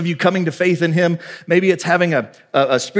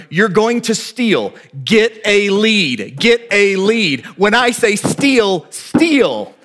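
very slow, raised, word-by-word instruction; blatant coaching call; 4 semitones higher, much slower than usual. Retold or imitated. First base coach openly instructing his runner, slow and deliberate. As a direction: spell it out for everyone to hear, slow and high. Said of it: "everybody can hear it".

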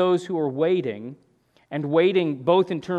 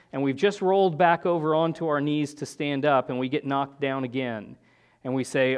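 ordinary talk, nothing marked

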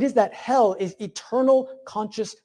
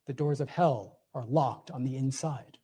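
soft echo; 7 semitones lower, 9 dB quieter than usual. Acted. Repeats the quote, dropped.

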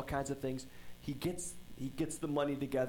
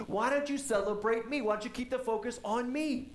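higher and louder, a whining complaint; sulky older brother demanding to be celebrated; older brother whining at his father, jealous and loud; jealous whiny brother; 8 semitones higher, 4 dB louder than usual. Retold or imitated. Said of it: "He's like".

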